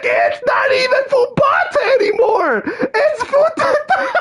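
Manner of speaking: very high, yelping frustrated shout with a laugh; mock exasperated yell. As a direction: screech the correction in disbelief. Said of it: "I'm like".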